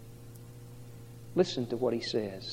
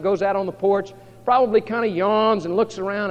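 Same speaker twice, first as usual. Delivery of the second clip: loud, driving Bible storytelling; full-force preaching; 6 semitones higher, 10 dB louder than usual. Acted. Preacher telling Elisha's story to the congregation, forceful.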